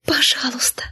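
высокий всхлипывающий шёпот; выдохни мольбу сквозь рыдание; отчаянный всхлип. A narrator's reading. (всхлип шёпотом, высоко)

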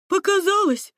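high, loud brush-off; dismissive man. A narrator's reading. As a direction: wave the noise away. Second man dismisses noise.

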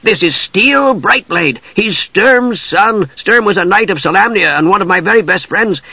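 high-pitched eager kender voice; enthusiastic. A narrator's reading. Tass introducing his friends to Usha, high and enthusiastic. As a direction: make keen introductions in a high voice. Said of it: "said Tass enthusiastically".

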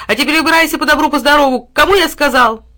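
fast, high, loud angry order; angry shout. A narrator's reading. Boy chasing the dog off, fast angry shout. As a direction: drive him off fast.

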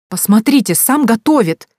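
high, loud, squealed mockery; shrieked. A narrator's reading. Woman exclaiming over his cooking, shrieked. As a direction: squeal it in scornful amazement. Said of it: "взвизгнула Лиза".

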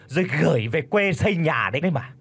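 piercing high sneering accusation; shrill sour voice. Acted. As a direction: needle her in a shrill sharp voice. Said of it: "cất cái giọng chua ngoa lanh lảnh". (giọng chua ngoa lanh lảnh)

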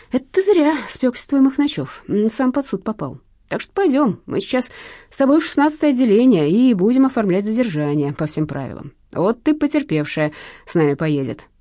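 lightly voiced police veteran; unyielding plainclothes cop. A narrator's reading. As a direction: lay down the law to a thief.

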